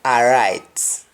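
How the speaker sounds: Jamaican "all right" with an open "ah" first vowel; Jamaican accent. Shown as a demonstration. Teacher showing learners the Jamaican "ah", Jamaican accent. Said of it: "In Jamaican, we say ah".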